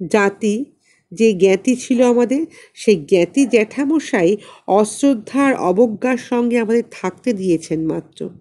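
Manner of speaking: ordinary delivery, nothing marked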